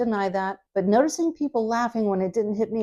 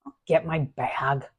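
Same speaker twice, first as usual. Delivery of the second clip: slow, sighing surrender; defeated; 3 semitones lower, slower than usual. Shown as a demonstration. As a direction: give up, resigned. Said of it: "like it's a surrender or a defeat".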